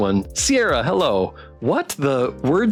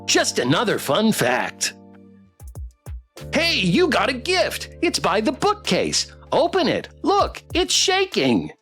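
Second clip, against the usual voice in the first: sing-song robot pet reciting facts; bouncy swooping pitch, rising to high excitement; eager plush companion voice; chipper toy pet; 5 semitones higher than usual. Acted.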